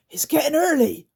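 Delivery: urgent little goblin; creaker voice. Acted. Grunt warns the others dawn is coming, high creature voice.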